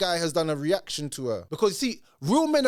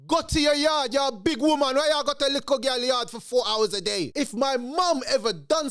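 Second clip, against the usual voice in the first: scolding; 7 semitones higher than usual. Real, not acted. Scolding the woman.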